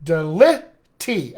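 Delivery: deli-tea, slow, only the stressed syllables de and tea; slow stress beats. Shown as a demonstration. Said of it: "I have a shus right in the middle".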